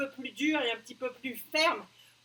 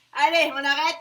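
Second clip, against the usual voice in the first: appel fort; 10 dB louder than usual. Real, not acted. Coach ends set loudly.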